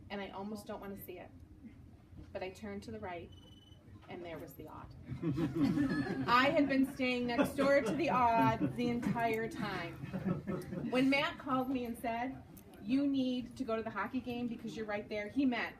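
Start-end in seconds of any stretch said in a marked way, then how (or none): 4.7-15.7 laughing through the punchline. Real, not acted. Telling the audience her blunder, laughing. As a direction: laugh at her own mistake.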